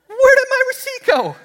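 very high-pitched comic squeal; high falsetto mock alarm; mock panic. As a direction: squeak it in a hammed-up fluster. Acted panic on losing receipt.

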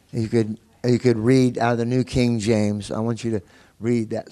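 normal talking voice

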